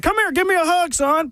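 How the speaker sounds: high, gruff mock biker dad; imitating girlfriend's dad. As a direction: voice a big biker dad hugging. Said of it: "he was like".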